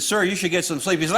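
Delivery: ordinary talk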